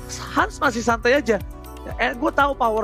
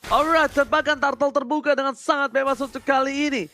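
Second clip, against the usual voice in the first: fighting game announcer; 4 semitones higher than usual. Acted. Caster calls team fight.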